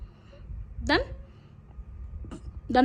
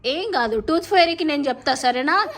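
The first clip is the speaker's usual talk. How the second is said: soothing; 2 semitones higher than usual. Real, not acted. Mother pleading with crying child, tender and soothing.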